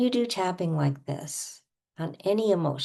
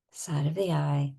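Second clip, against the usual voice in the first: ordinary delivery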